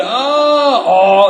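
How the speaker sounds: high, loud startled oh; surprised oh. Retold or imitated. Sponsor startled, loud surprised oh. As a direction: caught off guard, loud.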